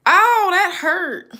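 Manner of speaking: loud, slow ow of pain; real pain as the strip rips; pained yelp. Real, not acted. Ripping wax strip.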